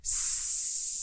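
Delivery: unvoiced long S hiss; just the S sound, hissed. Shown as a demonstration. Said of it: "Yeah, do that".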